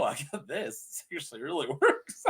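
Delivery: normal talking voice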